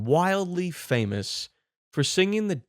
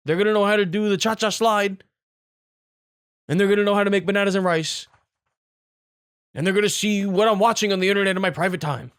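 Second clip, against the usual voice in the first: mock panic; 6 semitones higher, 4 dB louder than usual. Mock panic over stolen phone data.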